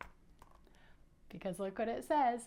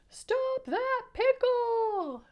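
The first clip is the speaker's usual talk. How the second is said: very high, slow, loud cartoon cry; shouting sandwich; 14 semitones higher, 6 dB louder, much slower than usual. Shown as a demonstration. Sandwich chugs after the pickle, high stretched shout. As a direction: shout it as a peanut butter and jelly sandwich. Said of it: "in a peanut butter and jelly voice".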